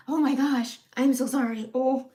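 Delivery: loud, choked-up apology laughing through tears; tearful apology. Real, not acted. Vlogger apologizing to viewers, laughing through real tears. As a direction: break down mid-sentence. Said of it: "I'm starting to get emotional".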